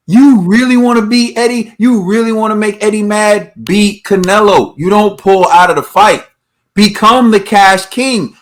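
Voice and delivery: ordinary talk, nothing marked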